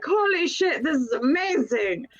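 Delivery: high, awed exclamation; mock gasp of delight; imitating wide-eyed amazement; amazed. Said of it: "they're like".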